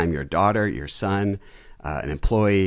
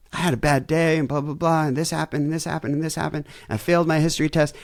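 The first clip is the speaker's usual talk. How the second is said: put-on upset teen daughter; 8 semitones higher than usual. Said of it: "So you might say".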